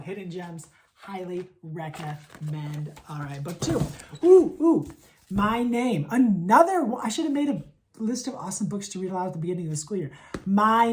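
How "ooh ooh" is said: high, loud eager "ooh, ooh"; two loud, high oohs of excitement; excited oohs. Real, not acted. Eager introduction of book two.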